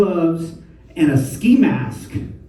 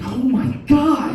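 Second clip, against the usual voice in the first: put-on high child voice; 9 semitones higher, slower than usual. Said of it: "my daughter's like".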